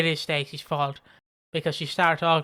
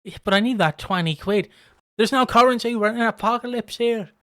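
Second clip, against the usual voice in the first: raised voice, insisting on the money; mock exasperated protest; 5 semitones higher, 6 dB louder than usual. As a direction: push back loudly about the debt. Quarreling friend in zombie scenario.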